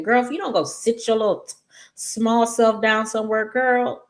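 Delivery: high-pitched, exasperated telling-off; irritated scolding. Real, not acted. Viewer telling Haley to sit down, irritated scolding. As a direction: put her in her place, pitched well up. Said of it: "I was so irritated with this".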